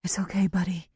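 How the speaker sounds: soft, weak whisper. A narrator's reading. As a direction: comfort him with no breath left. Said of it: "Claire says to him weakly".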